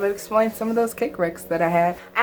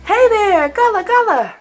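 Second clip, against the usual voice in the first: put-on much higher, bright sing-song greeting to children; sweet puppet-show voice, way up high; kids' show host voice; 12 semitones higher, 6 dB louder than usual. Host greeting the kids at the start of her show, sing-song high.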